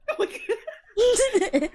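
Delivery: very loud, slow, high laughing cry; drawn-out hysterical shriek; laughing outburst. Real, not acted. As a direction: howl it out through laughter. Reacting to the closet reveal.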